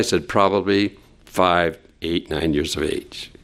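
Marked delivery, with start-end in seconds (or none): none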